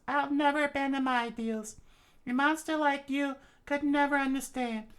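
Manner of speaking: very high falsetto defiance; defiant princess. Acted. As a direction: stand up to the monster in falsetto. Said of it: "she said".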